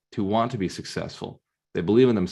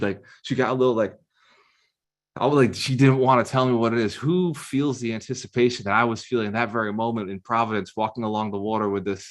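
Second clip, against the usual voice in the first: usual speaking voice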